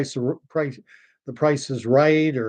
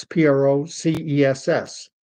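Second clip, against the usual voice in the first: ordinary talk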